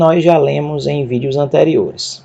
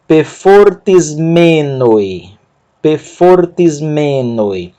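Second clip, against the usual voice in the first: slow "Pefortis menoi", "me" of "menoi" held long; measured Greek reading, "menoi" stressed on "me"; much slower than usual. Shown as a demonstration. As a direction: draw out the first syllable of "menoi". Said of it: "Sílaba tônica".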